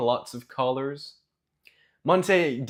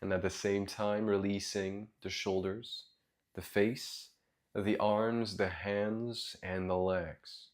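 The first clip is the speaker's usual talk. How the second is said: soft, low meditation voice with long pauses; guided meditation; 5 semitones lower, 8 dB quieter than usual. Real, not acted. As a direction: guide them gently into their breath, quietly and low.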